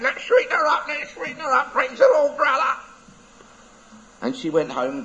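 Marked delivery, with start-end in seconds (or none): 0.0-2.8 very high squawking parrot chant; gleeful parrot. Acted. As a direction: crow it after her like a parrot. Parrot voiced in story.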